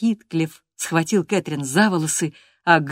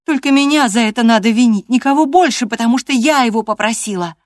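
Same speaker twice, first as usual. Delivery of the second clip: loud, high-pitched insistence; defiant cry; 7 semitones higher, 10 dB louder than usual. A narrator's reading. Catherine takes the blame before her father-in-law, crying out loudly. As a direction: cry out, taking all the blame. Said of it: "крикнула она".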